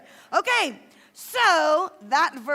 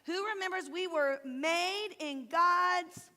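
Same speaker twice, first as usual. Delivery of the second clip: ordinary talk, nothing marked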